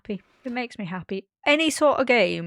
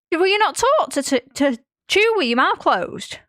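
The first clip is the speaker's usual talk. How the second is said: high, loud, exasperated scolding; mock indignant question; 9 semitones higher, 5 dB louder than usual. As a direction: play-act telling off a messy eater. Retold scolding of dad.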